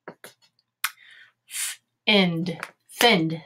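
airy Th-, ind, then blended thind; teacher blending thind from its sounds; sounding out. Shown as a demonstration. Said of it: "Here we go".

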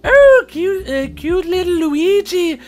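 high, slow hammy Irish leprechaun; badly faked Irish leprechaun brogue. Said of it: "That was a horrible leprechaun impersonation".

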